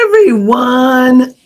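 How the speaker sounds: ordinary talk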